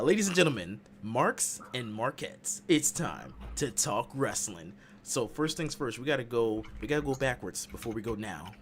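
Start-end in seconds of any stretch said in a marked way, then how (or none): 0.0-4.7 slow, drawn-out arena announcement; ring announcer. Pretend. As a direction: draw it out for the arena. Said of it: "Ring announcing is, is real".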